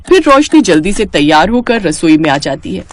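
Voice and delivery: ordinary talk, nothing marked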